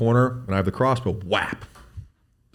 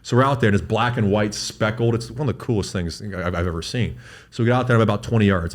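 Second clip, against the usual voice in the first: ordinary delivery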